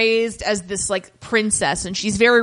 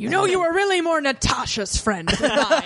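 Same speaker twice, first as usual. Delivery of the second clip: Liam Neeson; 5 semitones higher than usual. Retold or imitated. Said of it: "And Liam was like".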